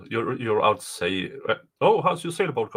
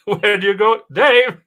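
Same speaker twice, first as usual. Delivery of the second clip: louder, higher call to someone who dropped off; calling out for Dave; 7 semitones higher, 9 dB louder than usual. Real, not acted. Host calling to a co-host who vanished from the call, loud call. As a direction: shout his name across the room, rising.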